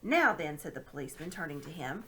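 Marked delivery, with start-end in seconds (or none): 0.0-0.6 stern constable. Acted. Policeman addresses Andrew.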